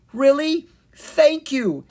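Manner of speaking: high, loud burst of joy; surprised delight. A narrator's reading. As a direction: exclaim as if barely believing it. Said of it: "eyes widened in surprise".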